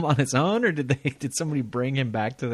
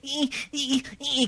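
much higher, high-pitched squealing; mock squeal; 5 dB quieter, slower than usual. Mocking porn actresses to his cohost, high squeal. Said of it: "They squeal".

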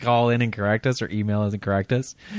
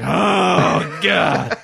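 husky moan; 7 semitones higher, 6 dB louder than usual. Retold or imitated. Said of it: "Just be like".

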